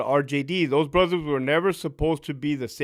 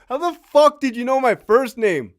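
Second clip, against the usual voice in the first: shocked disbelief; 6 semitones higher than usual. Retold or imitated. Shocked the reporter knew him.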